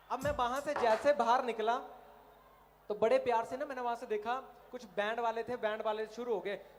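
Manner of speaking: normal talking voice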